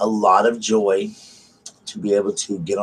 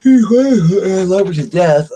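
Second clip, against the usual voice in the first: gushing declaration; 11 semitones higher, 8 dB louder, much slower than usual. Real, not acted. Gushing about friend Sharon.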